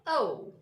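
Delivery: O as a falling two-part glide; careful British-style O; gliding O. Shown as a demonstration. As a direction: round O off towards "oo". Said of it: "pronunciation of this letter is O".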